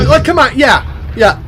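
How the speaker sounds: high, loud protest; can't believe what you see; excited reaction. Real, not acted. Host reacting to the shark tattoo, loud and excited.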